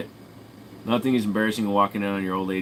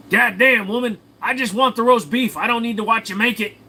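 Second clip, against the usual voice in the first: high, loud mock outburst; hammed-up exasperation; 13 semitones higher, 6 dB louder than usual. Husband complains to woman about roast beef, hammed-up yell.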